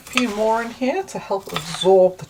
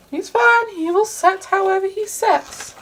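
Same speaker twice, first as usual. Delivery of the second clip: very high, slow, sing-song; lightly voicing a silly egg; playful high voice; 12 semitones higher, slower than usual. Mum voices a mini egg.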